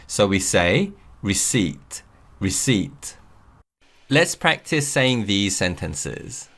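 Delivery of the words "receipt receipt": receipt said ree-SEET, no P sound; receipt, slowly, leaving the P silent. Shown as a demonstration. Teacher shows how to say receipt with a silent P. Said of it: "P is silent".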